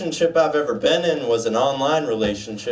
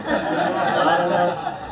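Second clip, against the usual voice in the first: slow drawn-out repeat; 4 semitones higher, much slower than usual. Acted.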